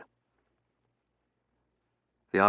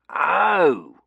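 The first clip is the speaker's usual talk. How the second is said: high, loud drawn "oh"; butler's raised cry of understanding; dawning realisation; 11 semitones higher, 11 dB louder than usual. Acted. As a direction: suddenly catch on. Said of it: "the light dawning".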